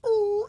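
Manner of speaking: very high, loud "ooh"; high echoing hoot. Acted. Player imitates Socks calling into the thing, high loud hoot. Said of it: "he just goes".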